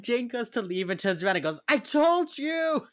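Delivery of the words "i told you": high, loud, slow triumphant call; mock-shouted gloat; super loud. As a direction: belt it back over the shoulder, slow and loud. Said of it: "Super loud".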